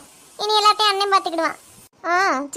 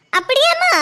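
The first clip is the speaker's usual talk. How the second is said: sarcastic retort; 9 semitones higher, slower than usual. Acted. Mother-in-law mocks daughter-in-law.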